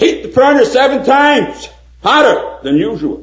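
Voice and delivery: high, loud royal command in fury; angry king shouting his decree; furious king. Acted. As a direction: bellow the order as an enraged king. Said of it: "the king was furious".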